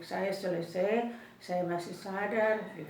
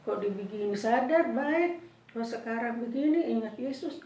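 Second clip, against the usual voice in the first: normal talking voice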